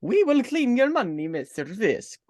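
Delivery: put-on thick Russian mobster accent; playful Russian gangster voice. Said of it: "I just went into Russian again".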